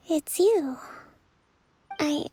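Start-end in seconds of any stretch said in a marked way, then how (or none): 2.0-2.3 tired, breathy half-whisper; barely voiced, drained of energy; weary whisper. Exhausted priestess confessing to a friend, whispering. Tired Kokomi admits weakness.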